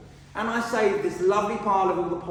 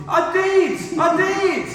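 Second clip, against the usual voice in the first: high, loud indignant shout; heckler shout; 9 dB louder, much slower than usual. Acted. Heckled speaker interrupts the evaluator, shouting loud and high. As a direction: shout it back as the offended speaker.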